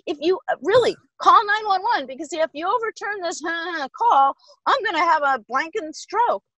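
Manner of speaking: half laughing, act out the disbelief; incredulous outburst. Retold or imitated. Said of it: "Buck, I was incredulous".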